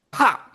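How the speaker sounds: high, loud popping sound effect; sharp pop. Acted. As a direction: pop it out like a hit. Sound effect of mace hit.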